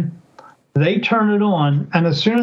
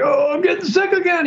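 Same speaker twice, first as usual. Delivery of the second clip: high-pitched imitation of a nauseous man; mock queasy whine; 11 semitones higher than usual. Retelling the investigator running out feeling sick, high mock whine. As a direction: pitch it way up, whining like he's about to be sick. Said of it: "He's like".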